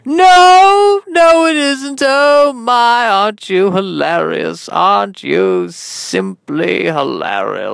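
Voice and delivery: high, loud gushing that slows and sinks; hammed-up host overcome with amusement; Sid Spielman. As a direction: start shrieking high, descend into a slow swoon. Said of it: "I'm your host, Sid Spielman".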